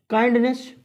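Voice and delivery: काइंडनेस ending in a clear "ness"; wrong ending demo. Shown as a demonstration. Teacher quoting a wrong reading, mispronounced. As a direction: show काइंडनेस with full e in last syllable. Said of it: "गलत".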